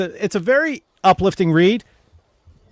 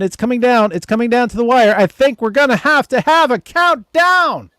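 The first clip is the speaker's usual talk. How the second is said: horse race announcer; 4 semitones higher than usual. Pretend. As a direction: call the home stretch breathlessly. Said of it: "horse race announcer voice".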